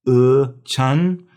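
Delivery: "uh", then CHEN with that same vowel, high and loud; vowel drill. Shown as a demonstration. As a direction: isolate uh, then put it into "chen". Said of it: "the E has an uh sound".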